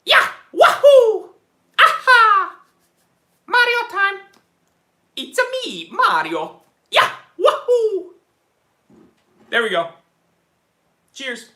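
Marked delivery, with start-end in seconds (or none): 0.1-8.1 very high, loud cartoon Italian accent; whooping, hammed-up Italian game-hero cheer; Mario. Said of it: "most authentic Italian accent".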